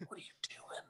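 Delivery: hushed, unvoiced whisper; concerned whispered aside. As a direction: whisper it like a discreet intervention. Friend quietly confronts him aside.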